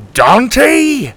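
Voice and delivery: high, loud call upward; surprised call. A narrator's reading. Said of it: "Drake called up to him".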